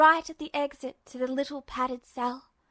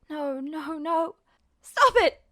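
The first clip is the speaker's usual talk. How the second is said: terrified shout; 3 dB louder, slower than usual. Acted. Ed screams at Justin to stop, terrified. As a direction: panic rising into a scream. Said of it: "he shouted".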